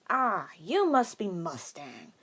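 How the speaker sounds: put-on slow old bartender call. A narrator's reading. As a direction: draw it out like an old barkeep. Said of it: "the bartender called".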